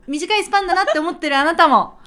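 ordinary delivery

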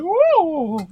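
high, loud amazed whoa; over eager infomercial. Acted. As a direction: gasp it like a thrilled ad customer. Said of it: "infomercial style reaction".

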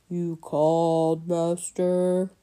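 slow, gloomy butler voice; deep somber voice. A narrator's reading. Boy imitating gloomy Mulch for the bat, a light slow somber voice. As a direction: drag it out mournfully. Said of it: "in his deep, somber voice".

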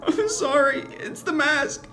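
high, loud desperate plea; frantic, high-pitched apology and begging; crying out. Acted. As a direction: shriek for help in panic. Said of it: "I cried for Luna".

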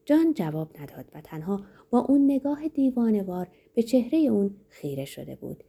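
ordinary delivery, nothing marked

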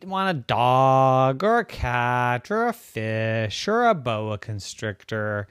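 plain reading voice

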